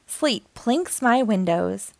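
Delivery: usual speaking voice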